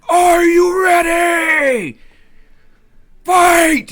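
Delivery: high, slow, loud fight call; booming fighting game announcer. Acted. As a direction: bellow the start of the fight.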